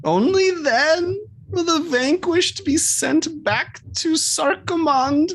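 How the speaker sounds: high, solemn creature voice. Acted. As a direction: recite the law as an otherworldly being. GM holds creature voice.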